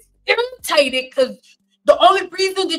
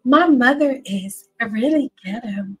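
imitating a mother's careful voice, climbing higher; mother's way of talking; 4 semitones higher, 3 dB louder than usual. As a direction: put on mom's proper voice, rising to a loud exclamation. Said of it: "this is how my mother talks".

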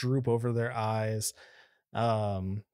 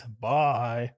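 gruff, a touch louder goodbye; big gruff voice; 3 dB louder than usual. Acted. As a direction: rumble it like a big gruff guy. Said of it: "in a big gruff voice".

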